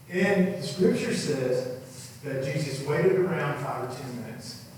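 ordinary talk

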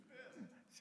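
breathy aside, barely voiced; whispered swear. Real, not acted. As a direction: drop the rude word as a hushed whisper. Swear word dropped mid-sermon.

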